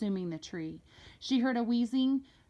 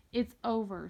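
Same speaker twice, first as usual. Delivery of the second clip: plain reading voice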